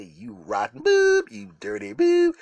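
high, loud, slow mock insult; jealous owner's glare. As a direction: drag out the scorn like a betrayed friend. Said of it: "as if to say".

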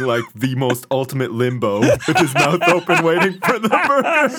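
cracking up mid-sentence; words breaking into laughter; laughing. Real, not acted. Laughing through the description.

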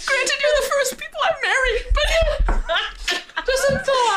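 high wailing sob through laughter; sobbing fool. Acted. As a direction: blubber it out, overjoyed and wrecked. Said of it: "The fool is also sobbing".

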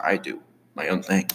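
ordinary talk

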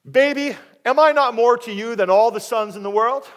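loud, high, hammed-up wheedling; clueless, self-important husband. Husband coaxing his childless wife, comically pleading. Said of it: "this guy needs some help".

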